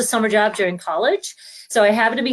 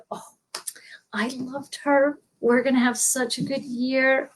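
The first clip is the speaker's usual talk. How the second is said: delighted teacher; 4 semitones higher, 4 dB quieter than usual. Retold or imitated. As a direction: gush over a favourite pupil's little sister.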